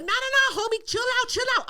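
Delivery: high, hurried plea to calm down; retold frantic talking-down; urgent pleading. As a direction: beg someone to stop as you chase them. Chasing her, pleading to stop.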